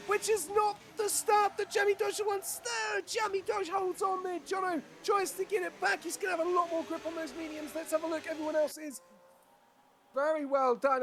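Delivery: high, excited motorsport commentary; urgent high-pitched race commentator; live race play-by-play. Real, not acted. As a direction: call the restart at full excitement, peaking then easing slightly.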